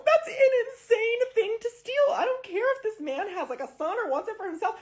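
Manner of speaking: a shrill rant at the thief; high indignant rant. Real, not acted. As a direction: keep the outraged pitch up. Continuing the outraged rant.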